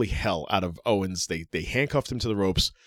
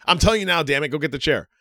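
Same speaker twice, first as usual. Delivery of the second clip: raised, higher voice giving an exasperated command; imitated angry order; 7 semitones higher, 5 dB louder, faster than usual. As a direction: retell it as a loud, impatient order. Acts out Reigns ordering Zayn.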